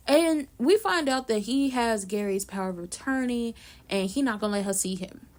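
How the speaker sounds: ordinary talk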